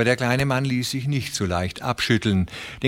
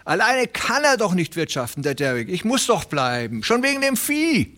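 high, fast, fretful little tenant pleading; a nervous little farmhand begging not to lose his place; Hooven the anxious tenant; 9 semitones higher than usual. Acted. Little tenant farmer pleading with Presley, high and anxious.

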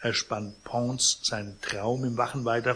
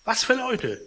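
high, loud fussy protest; concierge voice; 8 semitones higher, 4 dB louder than usual. Acted. Concierge protests to Pons, loud and fussy. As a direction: act the flustered concierge.

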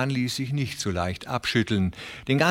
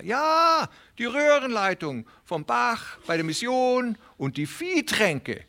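high, hurried pleading voice of a small worried farmer; anxious little tenant; 13 semitones higher than usual. Acted. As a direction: press him nervously, voice pitched up. Reader voices the tenant Hooven. (aufgeregter kleiner Pächter, hohe, drängende Stimme)

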